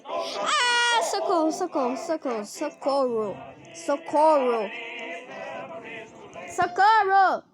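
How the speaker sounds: panicked cries for help; being chased. Real, not acted. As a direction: yell for help while fleeing in terror. Being chased by Cartoon Cat.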